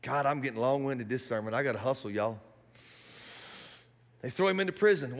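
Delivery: ordinary delivery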